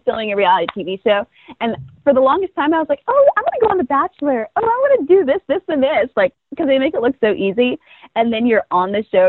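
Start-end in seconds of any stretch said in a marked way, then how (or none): 3.1-6.1 high-pitched eager wishing; eager past self. Retold or imitated. Contestant imitating her younger self longing for reality TV, eagerly. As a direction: gush it like a hopeful fan.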